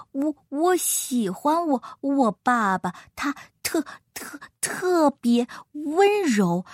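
slow stammering little elephant; 口吃的小象. Acted. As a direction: stumble and repeat the first syllables, slowly. (小象慢吞吞、结结巴巴地说)

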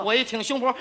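ordinary talk, nothing marked

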